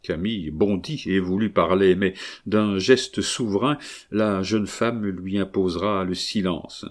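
plain reading voice

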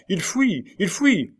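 high-pitched alarm call; sentry's cry. A narrator's reading. Sentinels raise the alarm on an escape, crying out. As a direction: cry the alert, pitch leaping up. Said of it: "quelques sentinelles se retournèrent en criant".

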